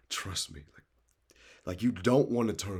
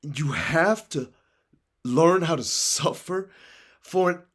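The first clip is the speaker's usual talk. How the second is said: louder and higher, preaching to the crowd; motivational preaching; 7 semitones higher, 9 dB louder than usual. Acted. Urging dreamers not to settle, in rousing preaching. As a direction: fire them up like a preacher.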